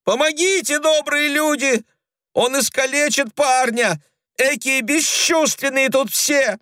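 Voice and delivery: high desperate shriek; desperate wailing. A narrator's reading. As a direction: wail in desperate panic. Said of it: "отчаянно голосила Клавдия".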